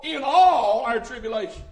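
"all" held long and loud; preacher underlining "all" for the congregation; stress on all. Shown as a demonstration. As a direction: lean hard on the word "all". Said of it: "underline that word all".

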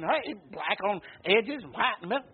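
normal talking voice

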